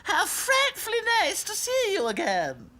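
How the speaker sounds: very high, hooting, affected greeting; funny-voiced wizard. A narrator's reading. Wizard surprising the princess dolls, affected. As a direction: gush with exaggerated, high politeness. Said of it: "speaks in all kinds of funny voices".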